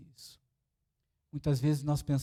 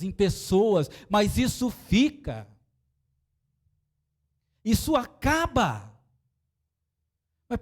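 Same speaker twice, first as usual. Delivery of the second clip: preaching at full force; 8 semitones higher, 6 dB louder than usual. Acted. Pastor exhorting the congregation, loud and high. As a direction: drive the warning home to the church.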